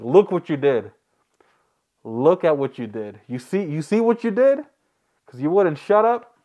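loud scolding, rising in pitch; mock angry dad. Dad telling off the kid afterwards, loud mock scolding.

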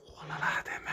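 hushed exclamation; voiceless oh là là; whisper. Real, not acted. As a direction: whisper your alarm. Hushed in a dark house.

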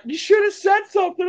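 high-pitched squawked quote; exaggerated high-pitched impersonation; mock exclamation. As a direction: act out a reply in a shrill, very high voice. Retold celebrity's shouted reply.

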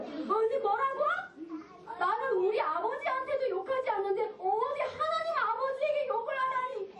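high-pitched outraged disbelief; shocked indignation. Acted. Young David hearing God insulted, high shocked indignation. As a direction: react aghast, voice pitched well up. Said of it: "깜짝 놀랐어요".

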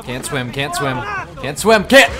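panicked. Real, not acted. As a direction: blurt it out in alarm. Panicking as character drowns.

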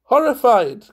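high, loud exclamation of delight; crying out. A narrator's reading. Valentine echoing Munt's question, crying out. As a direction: throw the word back in a high cry. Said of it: "cried Valentine".

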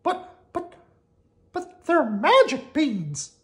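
high, slow, pleading mouse voice; sad Mickey. Acted. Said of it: "said Mickey as he sadly".

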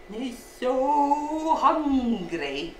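"so hungry" stretched right out; drawn-out emphasis. A narrator's reading. Storyteller on starving Big Jock, drawn-out emphasis. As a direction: draw "so hungry" out very slowly.

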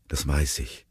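soft, subdued father's reply; murmured. A narrator's reading. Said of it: "murmelte Steve".

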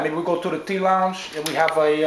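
ordinary talk

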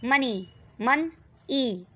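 मनी then मन ई pulled apart slowly; syllable split. Shown as a demonstration. Teacher to class, slow syllable split. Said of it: "इधर हम पढ़ रहे हैं two syllable word".

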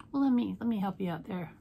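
ordinary talk

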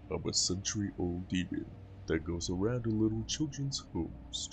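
lightly voiced century-old demon, boastful; a hint of a demon bragging to a child; a lightly voiced demon boasting to the child about stealing souls; demon monster.

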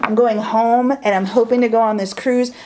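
normal talking voice

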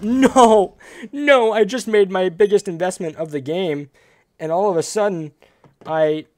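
loud, high cry of protest; dismayed outcry. Real, not acted. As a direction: wail at a sudden loss in the game. Loses his investment in game.

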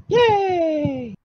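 high-pitched drawn-out yay; excited cheer. Acted. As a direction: cheer like a delighted cartoon character. Cheering character in comic dub.

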